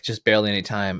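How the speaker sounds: ordinary talk, nothing marked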